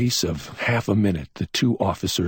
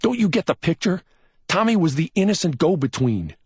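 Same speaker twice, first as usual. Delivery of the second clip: higher and quick, exasperated; impatient insistence; 12 semitones higher, 4 dB louder, faster than usual. A narrator's reading. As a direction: push the point hard. McMain pressing his point.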